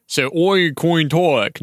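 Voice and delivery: slow drawn-out "oi coin talk" with oi for I; doing the girl's odd accent, slowly; hammed-up "so oi coin talk"; mock accent. Shown as a demonstration. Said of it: "noi oi coin talk".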